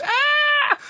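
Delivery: very high piercing monster screech; mock Godzilla shriek. Mother still playing Godzilla, very high screech. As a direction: let out a wild fake monster screech. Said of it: "then she goes".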